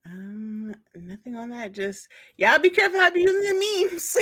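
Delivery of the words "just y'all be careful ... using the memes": loud, high teasing warning. Acted. Warning fellow podcast fans, loud and high teasing. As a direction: wag a finger at the listeners with a laugh.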